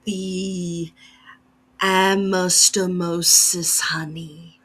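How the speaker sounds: slow, breathy whispered insect voice, ending in a buzz; a graceful, menacing bee creature whispering slowly; menacing bee woman. Acted. Bee woman names product.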